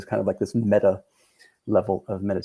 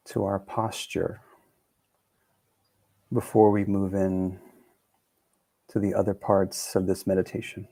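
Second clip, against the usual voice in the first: calm, soothing meditation. Real, not acted. Teacher opening a guided meditation, calm and soothing. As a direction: guide them gently, phrase by unhurried phrase. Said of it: "to a more calm and soothing speed".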